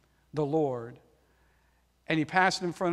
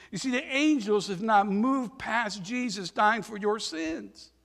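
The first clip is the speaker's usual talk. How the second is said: ordinary delivery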